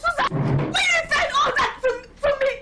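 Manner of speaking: very high, wailing shout of outrage; outraged protest. Acted. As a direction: cry out in stunned, high-pitched protest. Insulted friend protests to her.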